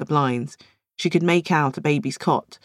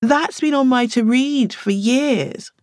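higher, slow, delighted; excited; 9 semitones higher, 3 dB louder, slower than usual. Acted. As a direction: savour the book you've longed for.

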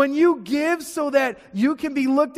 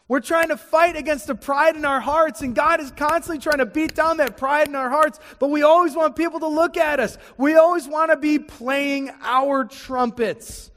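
louder and higher, pressing the point; rising preaching; 6 semitones higher, 4 dB louder than usual. Real, not acted. Preacher urging the church against pride, louder. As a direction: preach it with growing force. Preacher building to full force.

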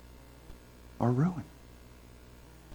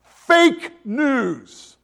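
louder, higher two-word pronouncement; a light take on God declaring it; God's verdict; 7 semitones higher, 8 dB louder than usual. Retold or imitated. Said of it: "what God says when he looks at you".

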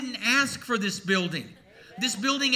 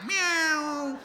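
kitty meow; 6 semitones higher than usual. Pretend. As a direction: mew once more like the cat. Kitty cat on a cold night.

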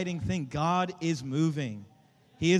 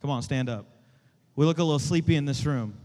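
usual speaking voice

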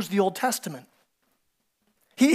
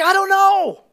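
much higher, loud exclamation; exclaiming; 12 semitones higher, 6 dB louder, slower than usual. Acted. Blind man shrugging off the leaders, high and loud. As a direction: throw it up in the air. Blind man's punchline answer.

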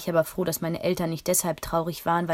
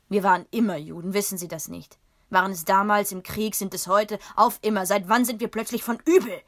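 rising, louder outburst; loud anger; 2 semitones higher, 5 dB louder than usual. Acted. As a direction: shout his outrage. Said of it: "so laut werden hören". (lauter, empörter Ausbruch des Vaters)

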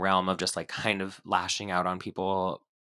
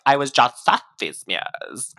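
Julia Fox impression; 3 semitones higher, 8 dB louder than usual. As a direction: mimic her breathy self-importance. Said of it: "It's like".

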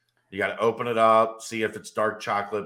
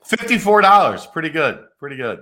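sarcastic; 8 semitones higher, 10 dB louder than usual. Acted. Sarcastic about the small discount.

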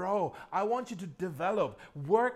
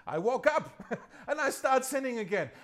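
laughing; 5 semitones higher, 5 dB louder than usual. Real, not acted. Laughing at own failed vow.

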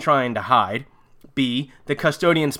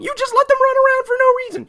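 high, fast squeal of mock explanation; sarcastic outburst; 4 dB louder, faster than usual. Real, not acted. Host ranting about the plot, sarcastic squeal. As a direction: rattle it off high in mock exasperation.

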